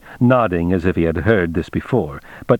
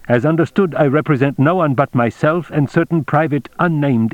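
higher-pitched formal captain; Baines, officially formal; 5 semitones higher than usual. Acted. Baines introduces himself to the general, formal higher character voice. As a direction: state credentials as an officer reporting.

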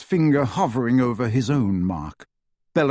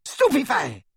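much higher screamed curse; screamed spell; 11 semitones higher than usual. A narrator's reading. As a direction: scream it again. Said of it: "she screamed".

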